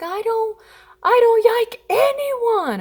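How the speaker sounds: high, loud, slow mock wail; crying toddler. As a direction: bawl like an overwrought three-year-old. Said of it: "he's in the bed crying".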